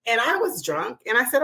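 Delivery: usual speaking voice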